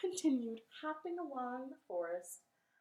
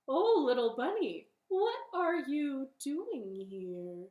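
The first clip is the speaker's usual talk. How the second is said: owl character; 5 semitones higher, 6 dB louder than usual. Acted. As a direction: play a loud, swooping, inquisitive owl. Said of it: "flew down from the sky and said".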